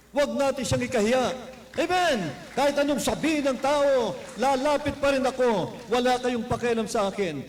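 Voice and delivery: loud, high preaching climax; fiery preaching. Acted. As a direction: push it out to the congregation. Preaching at full force. (buong lakas na pangangaral)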